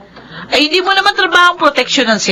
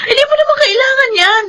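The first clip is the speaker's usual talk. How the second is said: high, crying shout; anguished plea; 8 semitones higher than usual. Acted. Wife crying out at husband over the gun. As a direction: cry out begging him, much higher.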